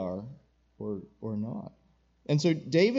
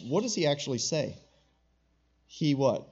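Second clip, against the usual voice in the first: ordinary talk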